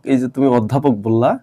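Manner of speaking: ordinary talk, nothing marked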